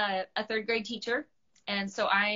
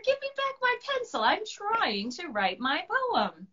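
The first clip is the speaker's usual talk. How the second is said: much higher, pleading dragon; high annoyed dragon demanding pencil; 11 semitones higher than usual. Acted. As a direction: demand it back, exasperated.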